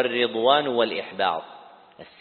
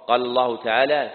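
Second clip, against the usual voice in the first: normal talking voice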